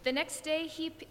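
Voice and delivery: ordinary delivery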